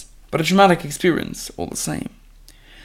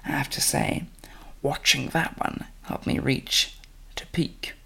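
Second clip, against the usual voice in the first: soft unvoiced whisper; 12 dB quieter than usual. A narrator's reading. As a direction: breathe it in a sultry whisper. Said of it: "smiled and winked".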